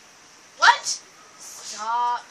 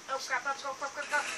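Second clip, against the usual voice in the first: ordinary talk, nothing marked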